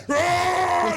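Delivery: loud, high imitated roar; mock roar. Old coach lunging at the rookie, loud mock roar. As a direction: roar like a charging bear. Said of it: "And he's like".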